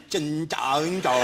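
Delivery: slow, drawn-out mock-Chinese "Jin dang dong"; mocking imitation. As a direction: mimic a botched Irish reading, stretching each syllable. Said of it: "is the bit where you went".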